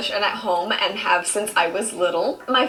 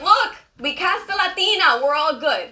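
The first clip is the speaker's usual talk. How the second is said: smug Hollywood; 6 semitones higher than usual. Retold or imitated. Mocking Hollywood studios praising their own casting, smug. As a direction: act pleased with yourself, self-congratulating. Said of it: "the way Hollywood looks at it is like".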